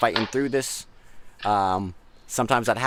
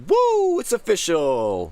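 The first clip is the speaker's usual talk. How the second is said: excited cheer; 9 semitones higher, 6 dB louder, slower than usual. Real, not acted. Cheering new team name.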